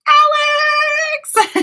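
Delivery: high, loud, drawn-out cry of a name; excited greeting. Real, not acted. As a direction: shout it in delight as a friend arrives. Excited greeting as friend joins.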